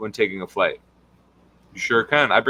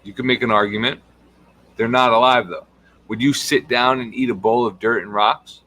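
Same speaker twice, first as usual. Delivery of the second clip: ordinary talk, nothing marked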